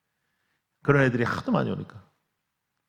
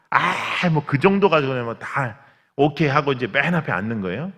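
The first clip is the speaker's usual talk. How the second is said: usual speaking voice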